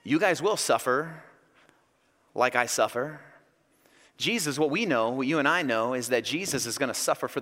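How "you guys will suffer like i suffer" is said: low, mildly exasperated; understated weary patience; eye-rolling resignation. Acted. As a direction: concede it with an eye roll. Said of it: "the eye roll comes because Jesus is like".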